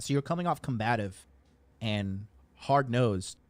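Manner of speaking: ordinary delivery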